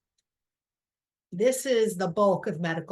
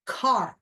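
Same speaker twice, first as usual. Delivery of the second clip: "car" isolated and said louder; emphasized syllable; 2 semitones higher, 5 dB louder than usual. Shown as a demonstration. Teacher singling out the stressed part, loud lone "car". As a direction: hit "car" alone, firm and loud. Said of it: "that's the emphasis".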